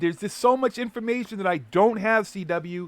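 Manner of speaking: loud, high, fired-up rant; heated rant. Real, not acted.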